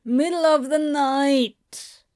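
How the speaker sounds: slow, drawn-out weary protest; Crowley refusing his friend's offer, protesting. A narrator's reading. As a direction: stretch it out as an objection. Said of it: "Crowley protested again".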